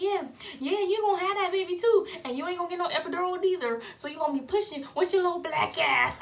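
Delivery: loud, high, rapid mock scolding; angry mom. Mom punishing pregnant daughter, angry. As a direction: lay down the law as a furious mom.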